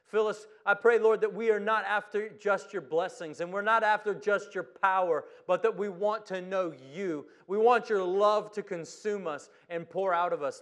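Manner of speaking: loud fervent prayer; preaching at full force. Acted. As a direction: cry out to God with fire.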